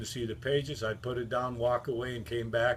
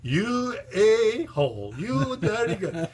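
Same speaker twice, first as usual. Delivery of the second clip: high, slow, louder mock outrage; 10 semitones higher, 6 dB louder, slower than usual. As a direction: drag it out, half furious, half laughing. [name] cursing after the prank.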